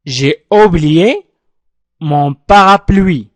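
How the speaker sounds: J'ai oublié mon parapluie, each word pulled apart very slowly; slow syllable-by-syllable model. Shown as a demonstration. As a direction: dictate it word by word for the class. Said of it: "أعيد النطق معي". (نطق بطيء مقطّع كلمة كلمة)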